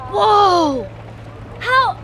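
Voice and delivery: loud startled exclamation; sudden loud whoa; surprised whoa. Real, not acted. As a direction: blurt it out at the shock. Sees shocking magazine photo.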